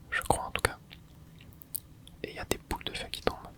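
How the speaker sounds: normal talking voice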